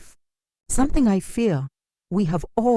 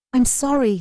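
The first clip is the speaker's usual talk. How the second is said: high, louder cry of sorry; choked apology; 10 semitones higher, 4 dB louder than usual. A narrator's reading. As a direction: force the apology out through tears. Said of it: "she choked out".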